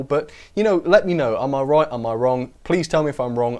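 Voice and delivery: ordinary talk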